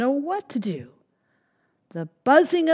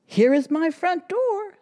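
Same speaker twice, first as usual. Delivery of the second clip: gentle bear person; 11 semitones higher, 5 dB louder than usual. Acted. Bear shows home.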